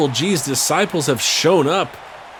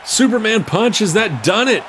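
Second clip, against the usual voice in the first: higher and louder hype call; excited shout; 9 semitones higher, 4 dB louder than usual. Real, not acted. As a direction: sell the big punch. Finishing punch called live.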